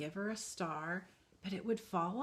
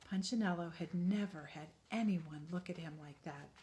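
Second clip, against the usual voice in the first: usual speaking voice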